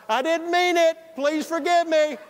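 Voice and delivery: hollering an apology out the car window; shouted apology. Retold or imitated. He shouts sorry from the car window toward the house, loud and high.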